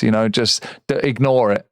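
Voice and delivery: ordinary talk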